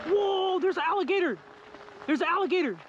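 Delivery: high excited alligator alert; thrilled sudden discovery. Real, not acted. Spots an alligator.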